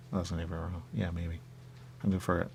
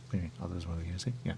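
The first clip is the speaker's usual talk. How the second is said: normal talking voice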